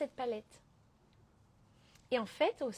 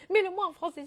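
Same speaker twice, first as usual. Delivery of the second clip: imitated pleading follower; 6 semitones higher, 6 dB louder than usual. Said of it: "il y en a tellement d'entre vous qui me disent".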